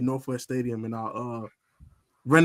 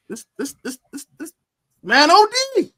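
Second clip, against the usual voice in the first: climbing higher into a loud burst; rising excitement; 12 semitones higher, 7 dB louder than usual. Retold or imitated. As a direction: let it build and pop. Live excitement retelling play.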